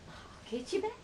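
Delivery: very high, singsong mock threat; acted-out vow of revenge; playful threat. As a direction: promise payback in a high, rising voice. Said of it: "I'm like".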